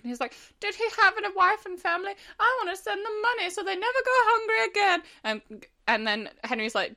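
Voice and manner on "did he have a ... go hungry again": very high, pleading do-gooder; mock remorse. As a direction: plead in hammed-up guilt, pitched right up. Acts Dorian's remorseful plea.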